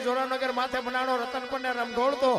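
loud, rhythmic chanted recital of a ballad; fiery dayro-style recitation of a village poem; folk poem recitation. Acted. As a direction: declaim each alliterative line in a driving rhythm, rising to a shout at the end. Recites Bhikhabhai Rabari's earthquake poem.

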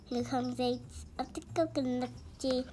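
usual speaking voice

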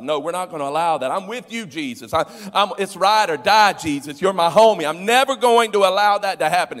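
loud, high, fast macho vow; alpha male bravado. Retold or imitated. Peter swearing to Jesus he'll stop it, hammed-up shout. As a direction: shout the loyalty like a tough guy. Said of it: "He's the alpha male amongst".

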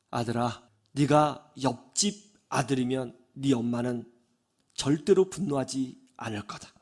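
low, soft, measured voice; calm motherly explanation. Retold or imitated. Mother follows upset son to his room, calm explanation. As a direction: settle down and explain gently.

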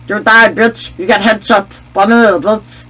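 high, loud victory crow; gloating taunt. Pretend. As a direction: crow over the headshot. Gloats over a headshot.

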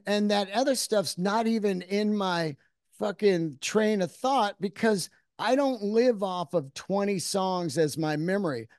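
normal talking voice

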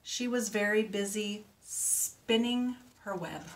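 ordinary delivery

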